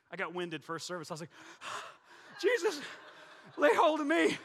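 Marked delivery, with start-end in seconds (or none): 1.4-2.4 gasp. Retold or imitated. Winded after running, gasping. 2.4-4.4 high loud breathless plea to Jesus; hammed-up gasping prayer, louder and higher; mock desperate prayer. Mock desperate prayer while winded.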